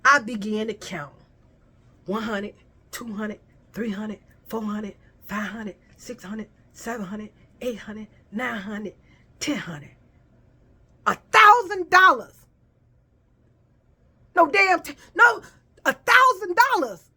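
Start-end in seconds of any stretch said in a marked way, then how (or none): 2.1-9.9 hushed counting. Retold or imitated. Counting money in awe. 11.1-12.3 higher and louder disbelief; stunned outburst. Retold or imitated. Shock at the sum. 14.4-17.1 higher and louder disbelief; stunned outburst. Retold or imitated. Shock repeated.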